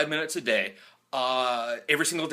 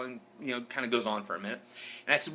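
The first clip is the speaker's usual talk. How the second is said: ordinary talk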